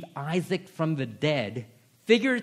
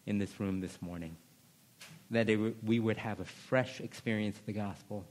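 lower, soft, unhurried prayer voice; pastoral prayer; 6 semitones lower, 5 dB quieter than usual. Acted. Pastor praying over the room, soft and reverent. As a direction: pray reverently for the congregation.

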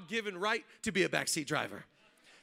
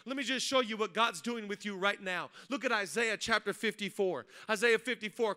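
ordinary delivery

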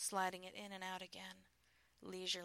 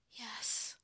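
ordinary delivery

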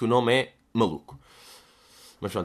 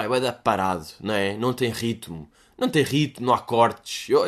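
ordinary talk

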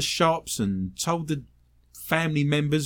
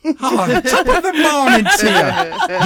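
hammed-up, high Irish greeting; slow, loud stage-Irish with laughter; Irish accent; 10 semitones higher, 5 dB louder, slower than usual. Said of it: "in an Irish accent".

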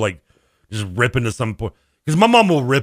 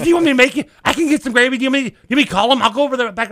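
high, frantic mock pleading; mom freaking out; 12 semitones higher, 4 dB louder, faster than usual. Son imitating his mom offering to fix the gravy, mock panic. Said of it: "starts freaking out".

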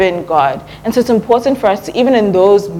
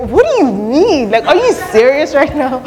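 high-pitched incredulous questioning; mock offended; 7 semitones higher than usual. Preacher imagining Jesus's reaction to being doubted, mock offended. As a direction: act affronted, pitch leaping, laugh creeping in. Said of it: "I don't know if Jesus is offended".

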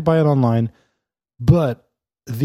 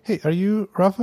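friendly stranger; 9 semitones higher than usual. Retold or imitated.

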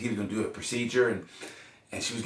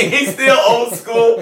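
laughing; 12 semitones higher, 12 dB louder, much slower than usual. Real, not acted. Laughing about dad.

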